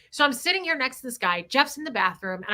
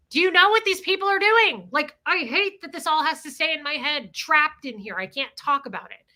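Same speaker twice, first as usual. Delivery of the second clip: blurted outburst; 5 semitones higher than usual. Retold or imitated. Bitter wife imagines blurting it to other wives, exasperated outburst. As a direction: let bottled-up frustration spill out. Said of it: "I wanted to blurt it out".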